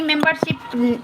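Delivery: ordinary talk, nothing marked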